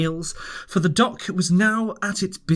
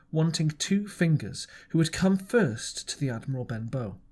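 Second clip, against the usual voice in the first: ordinary delivery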